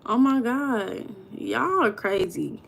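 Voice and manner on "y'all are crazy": ordinary talk, nothing marked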